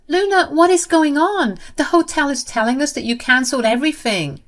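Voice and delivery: high, loud, panicky pleading; acted sister-in-law close to tears; panicked near tears. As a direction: blurt it out in a near-tearful panic. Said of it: "called me in a panic and on the verge of tears".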